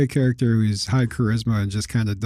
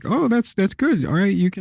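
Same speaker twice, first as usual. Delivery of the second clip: high mock approving voice; retold game master; 7 semitones higher than usual. Player retelling the game master's ruling, high imitating voice. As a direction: lift it high, imitating someone giving permission. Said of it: "he was like".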